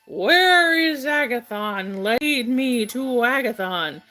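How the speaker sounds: put-on loud, slow drunken bellow; drunken roaring shout. A narrator's reading. Drunk reveler calling out for his host, roaring. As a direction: roar it out, dragging the words. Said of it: "kept roaring and shouting".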